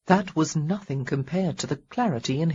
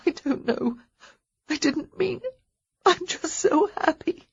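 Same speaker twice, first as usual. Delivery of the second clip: sobbing; 11 semitones higher than usual. Acted. Heroine crying with relief over him, sobbing. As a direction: pitch climbing higher into tears. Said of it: "gasping for breath between the sobs".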